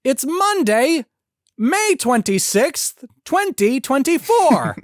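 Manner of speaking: very high, slow nasal announcing shout; hammy shouted weather report; David Lynch. Acted. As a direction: holler the date phrase by phrase, stretched out. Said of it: "why doesn't he do the weather report anymore?".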